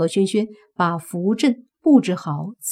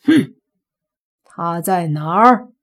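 stern grumble; 5 dB louder than usual. Acted. Father confronts his daughter.

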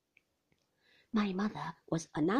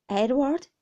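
loud, raised call of the name; insistent sister-in-law; 5 semitones higher, 10 dB louder than usual. A narrator's reading. Sister breaking in on her brother's quarrel, loud and insistent. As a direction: cut in firmly with "Edward". Sister interrupts again, firmly.

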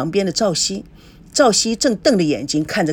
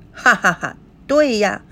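laughing; 4 semitones higher than usual. Acted. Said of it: "孙正笑着说".

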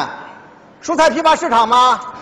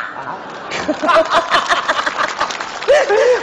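laugh; 4 semitones higher than usual. Real, not acted. Husband laughing at own joke.

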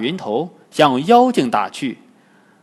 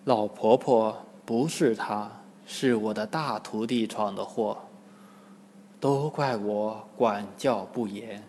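low, soft, halting admission; ashamed apology; 4 semitones lower, 9 dB quieter than usual. Acted. Tang Monk apologises to the old woman, ashamed. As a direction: take the blame quietly, in a lowered voice. 唐僧红着脸道歉.

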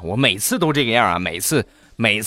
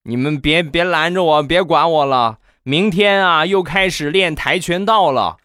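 put-on high-pitched, emphatic child's protest; self-righteous kid; 8 semitones higher than usual. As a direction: argue your case like an indignant little boy. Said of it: "而且还振振有词就说". (振振有词的小孩腔)

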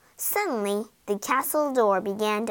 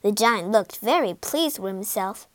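ordinary delivery, nothing marked

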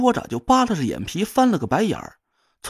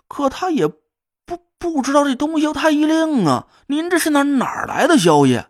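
put-on drunken surprise; 6 semitones higher, 4 dB louder than usual. Drunk Hu Lei startled, suspicious.

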